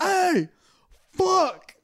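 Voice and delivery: high, panicked cry; mock panic. Paul Revere to the town, mock panicked yell. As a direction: cry out high as if alarm just hit. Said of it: "like, panicked?".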